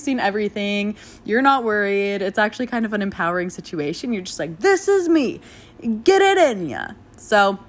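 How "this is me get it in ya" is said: high, hammed-up bravado; carefree bravado. Labouring mother feels empowered and shameless, hammed-up high bravado. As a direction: belt it out like a proud, silly show-off.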